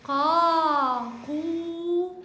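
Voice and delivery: قا، قو said loud and held; long vowel sounds. Shown as a demonstration. Teacher modelling qaf syllables for pupils to repeat, loud and clear. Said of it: "أنطق".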